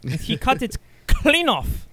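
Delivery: high, loud hammed-up German accent; mock German accent. Telling the sharp-knife ending in a high, loud mock German accent. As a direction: tell it like a grim German storyteller. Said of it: "German parable".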